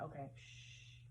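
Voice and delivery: breathy whisper to herself; whispered shush. Retold or imitated. Hiding in a dark locker room, whispering to herself. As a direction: hush yourself in a whisper.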